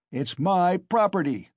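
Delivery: loud, high claim of ownership; defiant shout. A narrator's reading. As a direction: shout down all objections. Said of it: "shouted Mikolka".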